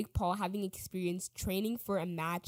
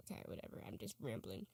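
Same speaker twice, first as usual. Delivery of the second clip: muttered aside; 10 semitones lower, 16 dB quieter, faster than usual. Real, not acted. Trailing aside to self.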